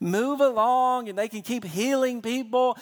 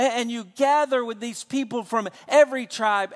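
ordinary talk

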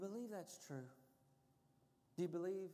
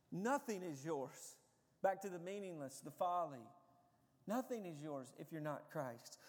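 ordinary talk, nothing marked

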